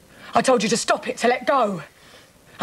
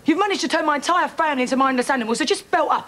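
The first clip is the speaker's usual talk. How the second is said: higher-pitched exasperated telling-off; angry outburst; 3 semitones higher than usual. Acted. Woman telling her family to belt up, angry. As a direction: cut him off, fed up and cross. Angry quarrel, tells him to shut up.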